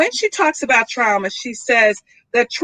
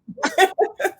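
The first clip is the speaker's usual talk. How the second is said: short laugh; 8 semitones higher, 3 dB quieter than usual. Real, not acted. Laughs at her own story.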